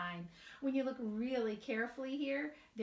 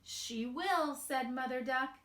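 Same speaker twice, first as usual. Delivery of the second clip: slow, sing-song storybook reading; gentle storybook narration with light character voices; reading to children; 2 semitones higher than usual. A narrator's reading. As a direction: read it aloud to a group of little kids. Reads picture book to children.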